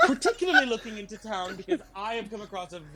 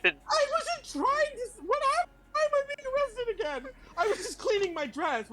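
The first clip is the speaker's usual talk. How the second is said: much higher, fast flustered protest; indignant protest. Acted. Bard protests to the guards, high flustered outrage. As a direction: sputter your innocence in a high rush.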